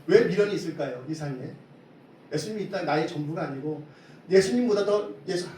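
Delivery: ordinary talk, nothing marked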